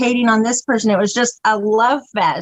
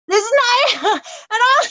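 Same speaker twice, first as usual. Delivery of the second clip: high squealing laughter breaking through the words; cracking up while retelling the insult; helpless laughter; 11 semitones higher than usual. Real, not acted. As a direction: laugh so hard the words barely get out.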